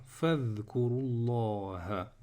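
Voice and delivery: slow "Fadhkurullaha" joined as one word; long a in linked "Fadhkurullaha"; linked reading. Shown as a demonstration. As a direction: recite "Fadhkurullaha" without a break before Allah. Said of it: "Je vais dire directement".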